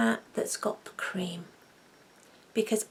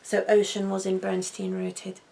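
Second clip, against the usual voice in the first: ordinary talk, nothing marked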